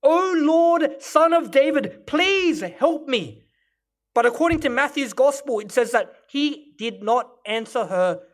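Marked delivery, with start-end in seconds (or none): 0.0-3.4 a mother's cry for help, higher and louder; desperate plea. Acted. Woman at Jesus' feet begging, hammed-up desperate cry. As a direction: beg him, voice rising, slowing on the last plea.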